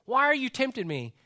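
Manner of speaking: half-pleading why me; mock complaint to God. Voicing a tempted person's complaint.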